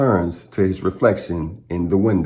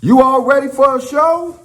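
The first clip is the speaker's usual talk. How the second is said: much higher, loud showman call; hype announcement; 3 dB louder than usual. Acted. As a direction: rev up the train crowd.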